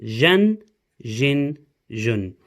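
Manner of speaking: Jen, Jin, Jun said clearly as a drill; teacher modelling Arabic sounds; Arabic syllable drill. Shown as a demonstration. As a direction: model each tanween syllable crisply. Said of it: "so we repeat one more time".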